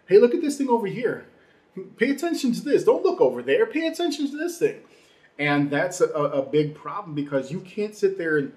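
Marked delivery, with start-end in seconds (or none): 0.1-4.8 high, loud mock call for attention; distracting commissioner. Podcaster playing Bud Selig diverting fans from steroids, mock urgent distraction. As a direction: jump up in pitch, imitating someone steering eyes away.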